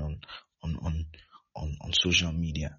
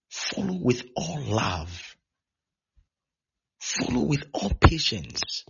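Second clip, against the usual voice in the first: slow, drawn-out urging; slow, weighty preacher's charge; emphatic exhortation; 6 semitones higher, slower than usual. Real, not acted. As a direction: stretch each word out as you exhort. Preacher exhorts congregation to follow.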